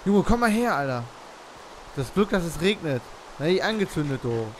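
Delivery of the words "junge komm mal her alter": loud high call; shouting. Real, not acted. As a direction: yell it across the map. Calling friend over, louder.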